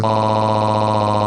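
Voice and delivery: drawn-out fake moan; hammy held moan; mock moan.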